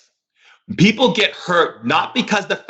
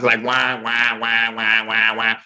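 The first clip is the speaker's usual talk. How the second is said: low, droning muffled wah-wah; Charlie Brown wah; 5 semitones lower, slower than usual. Retold or imitated. Shaman mocking critics' words as a muted Charlie Brown trombone drone. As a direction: drone it like the adult voices in Peanuts. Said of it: "Charlie Brown sounds".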